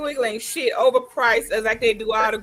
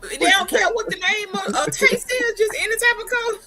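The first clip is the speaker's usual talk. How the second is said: high-pitched, amused exclamation; tickled, voice riding up high; laughing; 11 semitones higher than usual. Real, not acted. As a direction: say it through real laughter. Joking along about cold drink.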